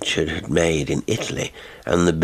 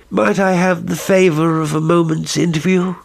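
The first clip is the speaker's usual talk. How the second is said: a new, much higher voice cutting in; lightly voiced courteous stranger interrupting; polite visitor; 10 semitones higher, 4 dB louder than usual.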